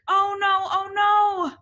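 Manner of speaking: high, loud mock alarm; a storyteller pretending to panic. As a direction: ham up the worry for the kids. Reader alarmed at falling.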